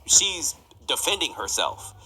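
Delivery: ordinary delivery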